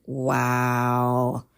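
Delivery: low, drawn-out wow; amazed wow. Retold or imitated. Mother hears her ex is sending money, amazed. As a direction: let the wow stretch out low and long. Reacting to news of money sent.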